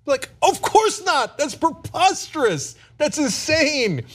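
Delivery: high, slow, incredulous; like it's absurd; incredulous outrage. Real, not acted. Outraged reply to the proposal.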